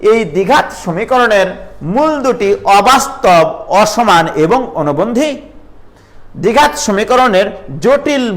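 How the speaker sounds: slow dictation. Shown as a demonstration. Teacher dictating key rule.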